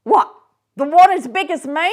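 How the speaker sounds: loud high lad's reply; street boy. Acted. The boy answering Scrooge from the street, cheeky. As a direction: call back up cheekily.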